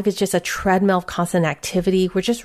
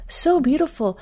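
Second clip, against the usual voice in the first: moved delight; 8 semitones higher than usual. Real, not acted. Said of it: "repeats with more feeling".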